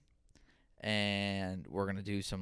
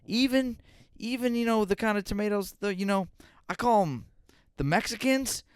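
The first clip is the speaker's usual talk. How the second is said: put-on much higher, louder old-man voice; imitating grandpa; 11 semitones higher, 4 dB louder than usual. Grandson retells grandpa's tomato remark, high imitation. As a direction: play the oblivious grandpa, pitched up. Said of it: "He goes".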